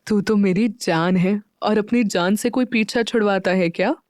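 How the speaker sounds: usual speaking voice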